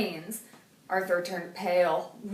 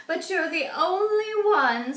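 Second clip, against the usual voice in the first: put-on old lady voice; 9 semitones higher, 5 dB louder than usual. A narrator's reading. Mrs Tibble speaking.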